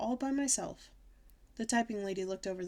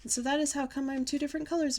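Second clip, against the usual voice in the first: put-on higher-pitched eager kid explanation; excited telling; 6 semitones higher, 4 dB louder than usual. A narrator's reading. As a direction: gush the news in a raised voice. Excited explanation after joyful shout.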